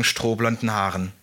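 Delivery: ordinary delivery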